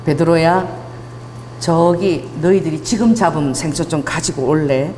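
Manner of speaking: low, slow, measured request; composed Jesus. Acted. Jesus to Peter on the shore, composed and low. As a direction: speak low and unhurried, with calm authority.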